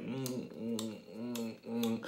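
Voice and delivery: quiet mm mm of a normal kiss; soft kissing hums. Retold or imitated. Showing the listener a normal kiss, soft hums. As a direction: hum softly like a gentle kiss. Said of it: "normally how you kiss and it's like".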